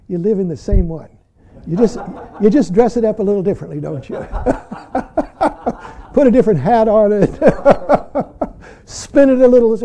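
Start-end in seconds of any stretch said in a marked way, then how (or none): 2.4-9.8 amused, laughing between phrases; real laughter breaking into the talk. Real, not acted. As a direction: crack up while teasing the listeners. Amused at own remark, laughing.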